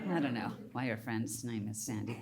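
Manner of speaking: wry throwaway; deadpan aside. Real, not acted. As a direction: mutter it to yourself. Aside to audience.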